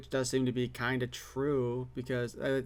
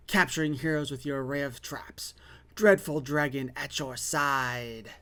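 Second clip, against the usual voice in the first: sneering panto villain. Acted. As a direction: hiss it like a gloating villain. Blurb ending whispered villain.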